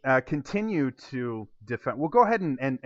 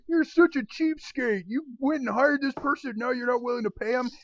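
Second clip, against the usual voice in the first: higher, mild accusing patron voice; scolding patron; 11 semitones higher than usual. Acted. Patron scolds the man for not paying, lightly voiced. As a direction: call him a cheapskate. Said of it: "The other half going".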